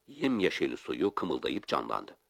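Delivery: usual speaking voice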